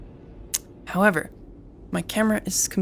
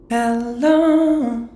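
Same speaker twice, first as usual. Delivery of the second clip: loud; 4 semitones higher, 7 dB louder than usual. Shown as a demonstration. Testing voice in VR app.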